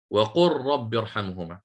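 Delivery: وقل رب run together as waqurrabb; lam merged into ra. Shown as a demonstration. Teacher repeats the verse for students, lam of وقل assimilated into ra. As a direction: hold a doubled R in place of the L of وقل. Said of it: "أنت أدغمت اللام الساكنة في الراء".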